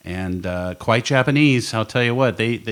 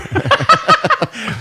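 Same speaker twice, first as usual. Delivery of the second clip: high laughter; 4 dB louder than usual. Real, not acted. Laughing at sperm whale joke.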